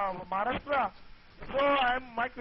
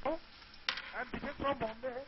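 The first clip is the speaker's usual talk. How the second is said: slow, soft introduction on a phone line; barely audible over the call; too quiet; 8 dB quieter, much slower than usual. Real, not acted. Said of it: "Speak louder, please".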